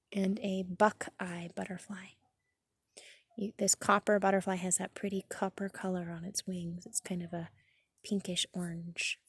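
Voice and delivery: hushed unvoiced whisper. Real, not acted. Reader whispering the refrain to the children. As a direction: keep it to a secret hush.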